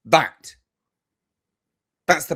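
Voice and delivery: an emphatic, scornful That. Real, not acted. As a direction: punch That. harder.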